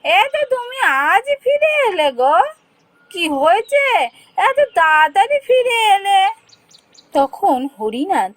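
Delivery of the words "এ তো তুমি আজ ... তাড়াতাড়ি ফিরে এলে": high, shrill surprised wife voice. Acted. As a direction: cry out as the startled wife. Wife surprised husband returned early.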